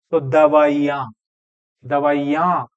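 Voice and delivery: दवाइयां with the weight on या, short ई; दवाइयां, stress on the या. Shown as a demonstration. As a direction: push the या in दवाइयां, keep the ई light. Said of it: "तो जोर तो या पर रहेगा".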